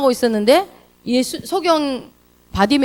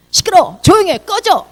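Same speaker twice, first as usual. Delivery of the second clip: high, loud, sharp rebuke; acted crowd telling someone off; angry shushing; 8 semitones higher, 5 dB louder than usual. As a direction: shout down a beggar. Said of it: "사람들이 뭐라고 그래요?".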